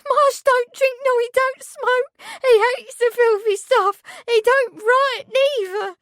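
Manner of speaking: high, loud, tearful insistence; hysterical protest. A narrator's reading. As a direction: plead his innocence through tears. Meg keeps protesting hysterically.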